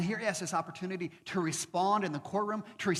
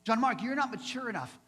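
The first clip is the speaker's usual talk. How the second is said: impatient Paul; 7 semitones higher, 5 dB louder than usual. Retold or imitated. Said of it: "Paul was the guy who was like".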